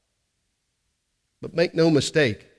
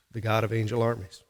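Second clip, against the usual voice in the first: hushed reverent; 5 semitones lower, 7 dB quieter than usual. Acted. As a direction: lower and softer, settling the refrain. Refrain repeated after the hush.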